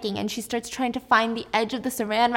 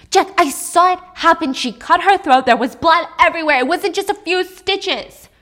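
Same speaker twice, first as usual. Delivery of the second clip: loud, higher, rushing at the end; panicked pleading; 5 semitones higher, 7 dB louder than usual. Acted. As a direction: make him believe you, frantic. Alice pleads with Jack.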